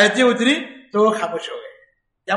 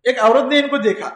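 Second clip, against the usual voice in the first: ordinary delivery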